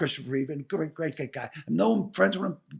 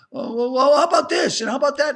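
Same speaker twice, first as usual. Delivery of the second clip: higher, louder mock pleading; imitating eager persuasion; 7 semitones higher, 4 dB louder than usual. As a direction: play a pushy producer piling on offers. Acting out producers sweetening an offer.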